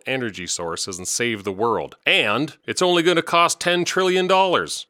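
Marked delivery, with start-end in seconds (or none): 2.1-4.9 sarcastic. Acted. Sarcastic punchline about cost.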